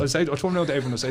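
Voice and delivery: ordinary talk, nothing marked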